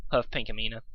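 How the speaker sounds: plain reading voice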